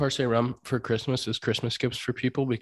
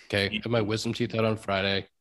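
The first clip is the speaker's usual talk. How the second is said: normal talking voice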